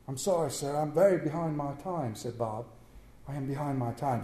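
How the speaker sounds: soft, meek clerk; timid apology. Acted. Bob apologizing to his stern employer, softly.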